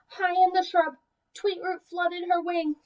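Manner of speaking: put-on gentle rhyming picture-book narration for young kids; storybook read-aloud. A narrator's reading. Teacher reading a picture book to preschoolers, storybook voice. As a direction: read the rhymes to the class with easy lilt.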